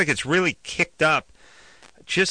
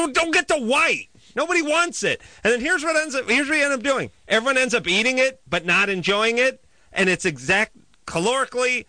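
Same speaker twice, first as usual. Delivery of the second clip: worked-up rant; 11 semitones higher than usual. Acted. Ranting about sheet cake at meeting.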